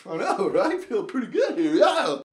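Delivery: laughing, cocky and loud; cocky reply. Retold or imitated. Cocky skier answering his friends, laughing. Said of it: "And I'm like".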